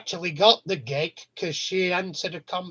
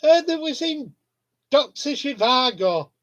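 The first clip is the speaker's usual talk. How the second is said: Trigger line pitched higher and louder; high mock Trigger; 6 semitones higher, 4 dB louder than usual. Trigger explaining to friends, higher and louder. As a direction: say it high and sure. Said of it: "He says".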